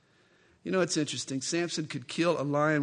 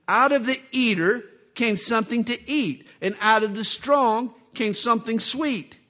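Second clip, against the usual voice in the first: louder, higher riddle chant; Samson's riddle; 5 semitones higher, 6 dB louder than usual. Acted. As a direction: lay the riddle down like a dare. Samson poses his riddle.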